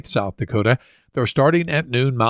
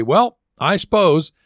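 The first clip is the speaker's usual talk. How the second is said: slow, higher, drawn-out Midwestern sigh of leaving; Midwest goodbye; 6 semitones higher, 3 dB louder, slower than usual. Shown as a demonstration. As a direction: stretch it out like a polite Midwesterner about to get up. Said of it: "We slap both hands on our knees and say".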